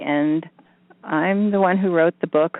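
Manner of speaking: ordinary delivery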